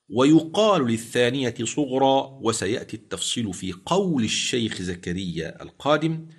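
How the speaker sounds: ordinary delivery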